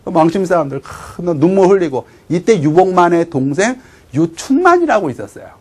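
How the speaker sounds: ordinary delivery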